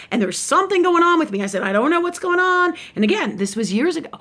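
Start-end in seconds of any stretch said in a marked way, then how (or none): 0.1-1.4 higher-pitched worried plea; retold distress. Retells anxious call to shaman. 1.6-2.7 higher-pitched worried plea; retold distress. Retells anxious call to shaman.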